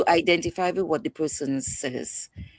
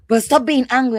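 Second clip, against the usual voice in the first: scolding; 8 semitones higher, 7 dB louder than usual. Retold or imitated. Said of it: "it gets me angry when someone tells me".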